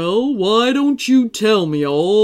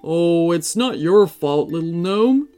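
reassuring giant; 3 semitones lower than usual. Acted. Santa reassures the guilty gnome, deep and kind. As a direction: comfort the little one.

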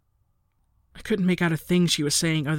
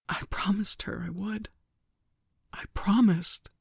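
softer whispered, drifting into breath; 4 semitones higher, 3 dB quieter than usual. A narrator's reading. Narrator whispering to mother about broken promise. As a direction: whisper it, spent. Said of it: "My voice now a whisper, too".

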